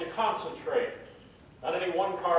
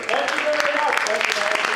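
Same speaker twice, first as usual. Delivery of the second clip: excited thanks; 8 semitones higher, 8 dB louder than usual. Acted. Magician thanks assistant, crowd.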